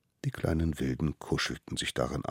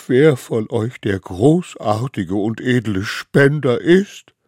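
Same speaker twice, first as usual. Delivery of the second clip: deeply moved; 8 semitones higher, 11 dB louder, slower than usual. A narrator's reading. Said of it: "außer sich vor Rührung".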